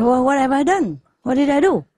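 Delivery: a husband baffled by his angry wife; bewildered husband. Acted. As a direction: play the innocent husband.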